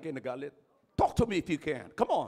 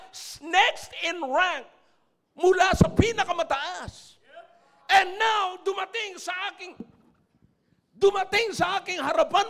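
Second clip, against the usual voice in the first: loud, higher preaching, building to shouts; fiery preaching; 10 semitones higher, 7 dB louder than usual. Acted. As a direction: press the congregation hard. Preacher at full force.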